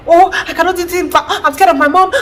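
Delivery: high mocking whine of a fearful daughter; scared grown girl. Vlogger mocking adult daughters afraid of mom, high whine. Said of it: "and they're like".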